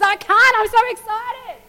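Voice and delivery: high, loud giddy voice of King David; bursting with giddy joy; excited king. Retold or imitated. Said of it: "He goes".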